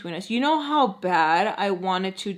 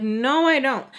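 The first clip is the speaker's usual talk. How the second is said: higher, louder emphatic denial; indignant retort; 5 semitones higher, 5 dB louder than usual. Retold or imitated. Girl challenging a vague "you know", indignant. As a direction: snap back with a stressed "no".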